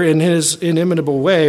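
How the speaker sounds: ordinary delivery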